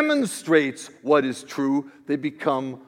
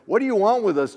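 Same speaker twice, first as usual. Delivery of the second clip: raised, high outcry from a reader; acted outcry, pitched up and louder; crying out; 7 semitones higher, 5 dB louder, much faster than usual. A narrator's reading. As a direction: cry it out in challenge. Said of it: "cried out".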